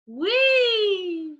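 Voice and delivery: bright, playful sung wee. Shown as a demonstration. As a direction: make it sparkle and cut. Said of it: "brightness would be like".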